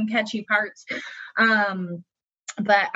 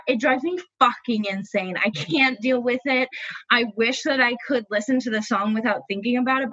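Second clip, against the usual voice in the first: ordinary delivery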